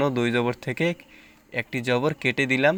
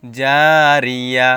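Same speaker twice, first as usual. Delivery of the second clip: very slow, louder chanted recitation; Quran recitation; 9 dB louder, much slower than usual. Shown as a demonstration. Teacher building up a word, chanting it slowly. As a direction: stretch the word out like tilawat. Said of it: "ইয়া জবর ইয়া".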